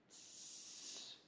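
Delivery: soft unvoiced hiss; snake hiss. Shown as a demonstration. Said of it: "That's the sound of a snake".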